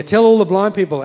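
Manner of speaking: loud proclaiming. Acted. Preacher to congregation, loud proclaiming. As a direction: boom out God's command. Preacher repeats God's command.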